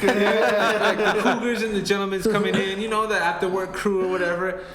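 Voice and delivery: laughing while talking; words breaking into laughter. Real, not acted. Laughing at his own pitch.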